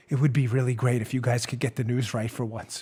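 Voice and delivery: soft, calm repeat of the line; lightly imitating a calm put-down; calm and peaceful. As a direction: say it as gently as possible. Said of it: "In the most calm, peaceful way possible".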